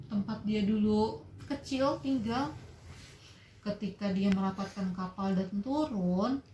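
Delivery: ordinary delivery, nothing marked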